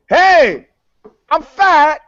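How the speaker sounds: high, loud hammed-up announcement of being fat; over-the-top mock celebrity statement; mock press conference confession. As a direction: belt it out like a proud public confession. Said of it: "hold the press conference and go".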